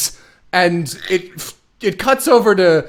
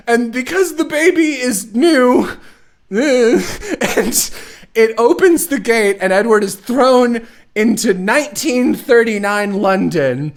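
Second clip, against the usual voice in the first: ordinary talk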